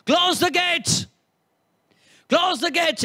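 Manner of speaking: angry command. Retold or imitated. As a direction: bark the order at the servants. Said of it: "the dad is like".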